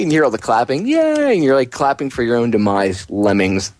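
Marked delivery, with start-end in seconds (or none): 0.9-1.3 much higher mock cheer. Mocking lemmings clapping for their own demise, cheering. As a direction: cheer like a brainless crowd.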